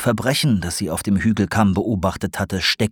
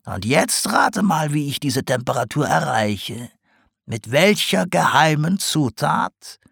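higher, knowing teasing voice; sly old potter; 7 semitones higher than usual. A narrator's reading. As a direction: tease the owl like a crafty old potter. Sarg explains her glazes.

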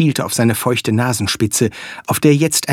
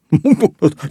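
giggling from a tickle; helpless ticklish chuckle; ticklish laugh; 5 semitones higher, 4 dB louder than usual. Acted. Flea tickles the dog.

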